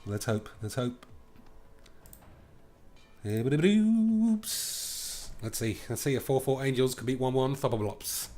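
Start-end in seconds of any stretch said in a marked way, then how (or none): 3.3-5.3 high mock oops.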